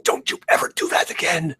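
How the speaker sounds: high, furious scolding. Acted. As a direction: scold him, fuming. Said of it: "I'm furious, I'm fuming".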